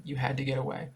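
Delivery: usual speaking voice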